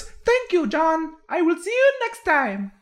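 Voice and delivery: very high, bright little bonsai creature; chipper little voice. Acted. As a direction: squeak it out like a tiny eager plant person. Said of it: "and he goes".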